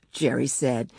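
ordinary delivery

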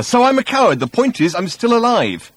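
high, heated self-defence; shouted. A narrator's reading. Ford defending his cowardice to Arthur, shouted. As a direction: argue back loudly, voice way up. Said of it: "shouted Ford at Arthur".

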